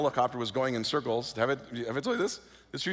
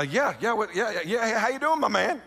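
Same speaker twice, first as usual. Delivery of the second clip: faking casual; 6 semitones higher than usual. Retold or imitated. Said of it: "pretend like everything is normal".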